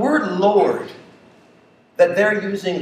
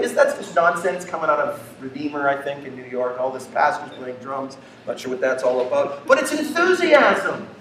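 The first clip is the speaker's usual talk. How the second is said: ordinary talk, nothing marked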